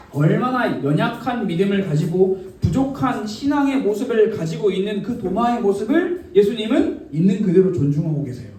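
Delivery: ordinary delivery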